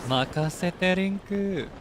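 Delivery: slow, high anime hero line; anime catchphrase. Pretend. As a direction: drawl it out like a cartoon hero.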